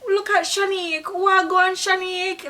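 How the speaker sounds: high, bright call to a friend across the street; excited greeting. Shown as a demonstration. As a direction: greet her warmly and high. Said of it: "You say".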